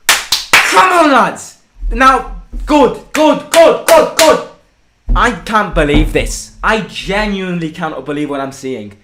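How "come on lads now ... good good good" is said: rallying cheer. Real, not acted. Cheering team on after goal.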